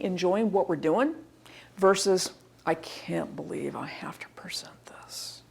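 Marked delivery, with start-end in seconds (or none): 2.6-5.4 dejected, low near-whisper; reluctant presenter. Acted. Unwilling presenter to an audience, low and barely audible. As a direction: mumble it under your breath, dreading the task. Contrast to genuinely enjoying the topic.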